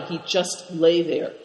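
usual speaking voice

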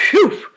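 high-pitched whew after strong drink; shuddering whew. A narrator's reading. Good Fortune after a glass of strong drink, shuddering. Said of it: "then shuddered and said".

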